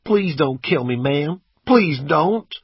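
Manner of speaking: high, loud, desperate plea; begging. A narrator's reading. Terrified driver at gunpoint, begging. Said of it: "He begged".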